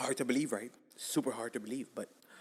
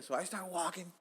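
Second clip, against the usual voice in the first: normal talking voice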